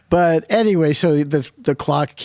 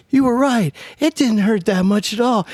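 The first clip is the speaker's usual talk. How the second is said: put-on proud kid; 8 semitones higher than usual.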